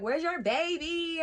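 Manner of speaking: high, drawn-out cooing question; eager greeting. Retold or imitated. Churchgoers run up asking after the baby, eager high greeting. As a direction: ask it high, stretching the words out.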